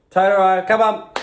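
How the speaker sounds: loud, high call to the dog. Real, not acted. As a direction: yell for the dog to come. Calling the dog loudly.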